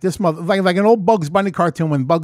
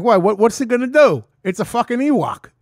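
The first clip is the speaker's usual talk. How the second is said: louder, higher mocking dare; brash taunting brag; cocky bravado; 6 semitones higher, 5 dB louder than usual. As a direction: scoff at the danger. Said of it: "I'm like".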